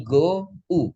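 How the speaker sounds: "go" then "u", the gliding vowel split in two; sound-by-sound demo. Shown as a demonstration. Teacher splitting goat for learners, "go" then "u". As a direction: break it into "go" and "u". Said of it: "Langsung jadi turun gitu".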